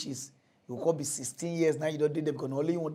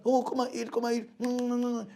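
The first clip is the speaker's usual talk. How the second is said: coaxing keeper; 7 semitones higher than usual. Retold or imitated. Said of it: "then they go dey wake up".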